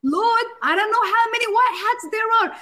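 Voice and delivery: high, pleading worry; anxious prayer. Retold or imitated. Believers praying to the Lord, high anxious pleading.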